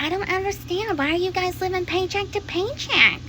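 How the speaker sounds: a hint of a high, mocking voice; mocking people. A hint of mocking clueless people asking about paycheck living. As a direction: lightly mimic clueless people. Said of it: "And people are like".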